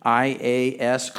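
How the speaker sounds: usual speaking voice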